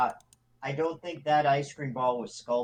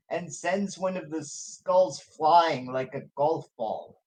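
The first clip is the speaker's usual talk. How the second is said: ordinary talk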